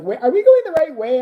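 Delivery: mock panicked passenger; anxious Steve. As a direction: imitate a lost driver. Imitating lost Steve.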